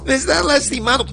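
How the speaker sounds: high, urgent begging in pain; pained pleading. Acted. The inmate begging his attackers to stop, high and pained.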